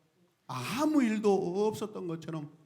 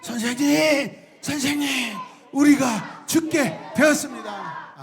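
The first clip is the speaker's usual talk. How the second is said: higher, slow urgent wail; desperate cry; 8 semitones higher, 6 dB louder, slower than usual. Acted. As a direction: drag it out in panic. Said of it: "뭐라고 이야기해요".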